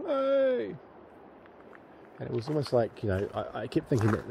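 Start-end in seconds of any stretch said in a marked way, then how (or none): 0.1-0.7 high call from far away; imitated distant yell. Unseen man calling out by the river, faint high yell. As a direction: mimic a faint shout over the river. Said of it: "You could hear".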